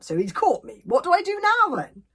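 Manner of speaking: rising panic. Acted. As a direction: fret it out loud. Hiccup's panicked thought.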